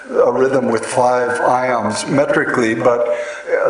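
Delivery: usual speaking voice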